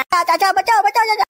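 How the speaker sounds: high, panicky call for rescue; acted high-pitched plea; crying for help. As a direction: call out to uncle to save you. Suspect cries out for help.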